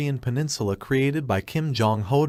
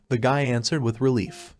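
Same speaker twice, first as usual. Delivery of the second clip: usual speaking voice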